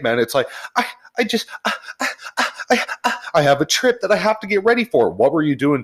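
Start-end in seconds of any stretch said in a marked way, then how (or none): 0.8-5.1 high, fast, stammering mimic of a fretful customer; whiny customer. Advisor mimicking a panicked customer before a trip, high whiny stammer. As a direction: squeak it out like a flustered customer.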